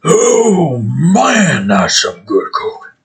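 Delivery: a high-voiced bear enjoying its coke, dragging the last words. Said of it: "that's what the bear would probably sound like as it does coke".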